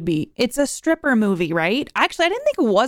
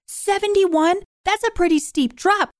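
surprised; 8 semitones higher than usual. Real, not acted. Shocked at a low rating.

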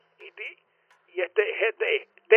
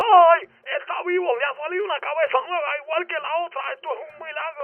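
high excited cry of wonder; amazed shout; 7 semitones higher, 4 dB louder than usual. Acted. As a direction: gasp out a miracle, loud then fading. Head regrows, reporter amazed.

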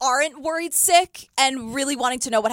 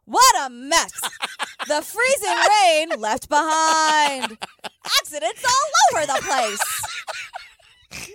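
hammed-up slow news anchor voice; Valerie Cherish impression; 5 semitones higher, slower than usual. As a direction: overdramatic reporter, dragging every word. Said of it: "It's like a really bad Valerie Cherish impression".

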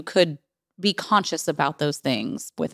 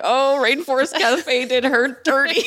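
mock sympathy; 8 semitones higher, 3 dB louder, slower than usual. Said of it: "And I was just like".

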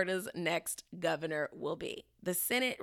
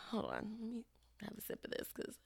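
whispered aside; 4 semitones higher, 11 dB quieter than usual. Real, not acted. Pausing for a sip.

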